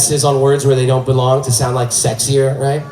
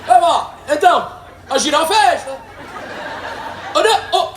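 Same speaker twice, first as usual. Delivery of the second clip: yelling across the plane; 13 semitones higher than usual. Retold or imitated. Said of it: "leans over your seat to yell".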